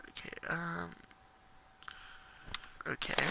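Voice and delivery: soft, unsure whisper; uneasy whisper. Real, not acted. Unsure what happens next, uneasy whisper.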